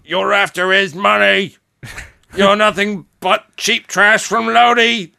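hammed-up Australian-sounding mom yelling; high, slow scolding that slips into Australian; accidental Australian accent. Dolly heckles from outside the front door, drifting into Australian. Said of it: "I don't know why I went Australian".

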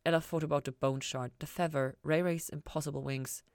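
plain reading voice

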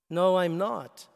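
a touch of a hoarse patient; lightly voiced hoarse reply. Groggy heart patient answering the nun, slightly hoarse. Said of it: "he said with a hoarse voice".

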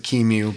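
QEMU said as one word, "KEE-mew"; own pronunciation. Shown as a demonstration. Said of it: "I pronounce it".

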